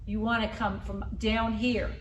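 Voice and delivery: normal talking voice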